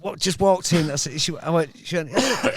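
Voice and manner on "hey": high mock-Thai greeting; a touch of Thai accent, pitched up; put-on Thai accent. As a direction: start imitating a Thai masseuse, high-pitched. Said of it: "I'm not gonna do the accent".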